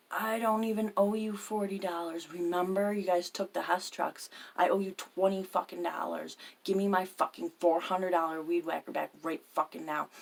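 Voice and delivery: heated, loud reenacted quarrel; retold angry demand. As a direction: argue back forcefully, hitting the swear words. Retold quarrel over weed whacker.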